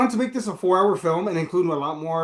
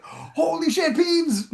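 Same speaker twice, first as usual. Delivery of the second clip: much higher, excited gasp of recognition; excited outburst; 12 semitones higher, much slower than usual. Retold or imitated. Fan spotting an actor, excited high outburst. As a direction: blurt it out in sudden delight. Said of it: "I was like".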